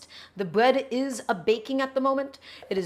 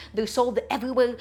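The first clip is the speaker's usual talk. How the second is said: normal talking voice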